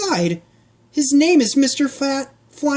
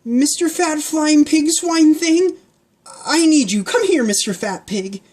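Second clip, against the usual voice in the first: put-on girl calling out; 11 semitones higher, 4 dB louder than usual. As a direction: call the big pig over. Pancake calls the pig.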